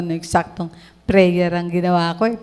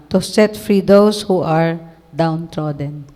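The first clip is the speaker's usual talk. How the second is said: scripture reading; 4 semitones lower than usual. Acted. Quotes scripture in slow reading.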